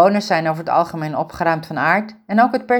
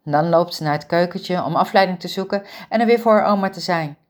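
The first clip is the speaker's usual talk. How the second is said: usual speaking voice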